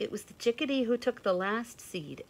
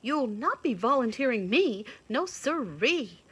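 scared rabbit; 6 semitones higher, 6 dB louder than usual. A narrator's reading. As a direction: refuse nervously. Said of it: "said the scared rabbit".

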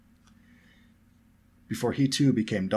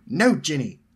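louder, raised motherly refusal; disapproving; 6 semitones higher, 8 dB louder, slower than usual. A narrator's reading. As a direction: cut the child off with firm disapproval.

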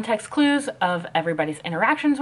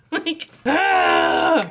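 mock anguished groan; 5 semitones higher, 3 dB louder than usual. Imitating panicked face.

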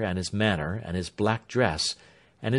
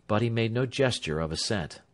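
usual speaking voice

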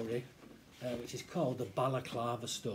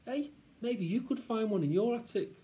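put-on high, bright-idea kid voice; excited boy; 10 semitones higher, 5 dB louder than usual. Friend suggests searching the attic, excited. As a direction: offer a sudden suggestion.

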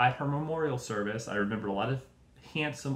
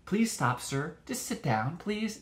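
much higher, meek begging plea; pleading polite cop; 8 semitones higher, much slower than usual. Retold or imitated. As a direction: beg the suspect politely to sit down. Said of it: "it's just like".

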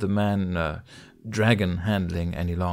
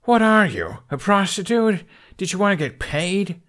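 sharp, higher voice, scornful and furious; angry accusation; 11 semitones higher, 6 dB louder than usual. Acted. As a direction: throw the accusation in her face. Hiccup confronts the thief.

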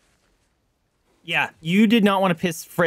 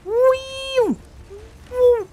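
gleeful squeal. Real, not acted. Sledding joy.

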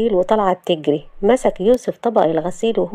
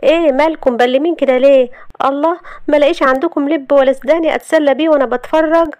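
higher, cheeky heroine voice; carefree Shahd; 7 semitones higher, 4 dB louder than usual. Acted. Shahd asking stunned family for snacks, cheeky and high. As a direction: pester them breezily, pitched up.

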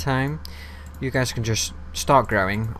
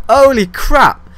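huge high gasp then loud cry; real panic as enemies swarm; shocked gasp; 15 dB louder, much slower than usual. Real, not acted. Ambushed by mobs, panics.

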